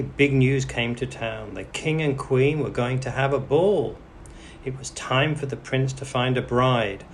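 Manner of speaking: usual speaking voice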